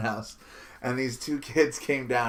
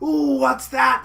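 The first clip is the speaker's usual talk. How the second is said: put-on high, slow, awed kid voice; wondering kids; 10 semitones higher, 5 dB louder, slower than usual. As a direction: ooh it like wide-eyed kids. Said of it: "the kids walking around were like".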